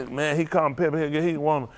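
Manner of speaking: ordinary talk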